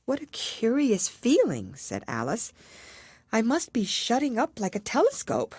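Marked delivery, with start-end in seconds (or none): 0.0-1.8 put-on high, slow, wondering little girl; a young girl marveling at a strange feeling; Alice's voice. Alice feels herself shrinking, slow wondering girl. 3.3-5.5 put-on a young girl marveling at a strange feeling; Alice's voice. Alice shrinking.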